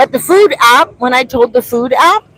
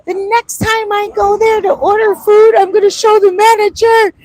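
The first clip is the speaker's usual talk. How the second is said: much higher, louder resolve; determined vow; 12 semitones higher, 5 dB louder than usual. Retold or imitated. Vlogger recalling her plan to confront the manager, high vow. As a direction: announce the plan with gusto. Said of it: "I was like".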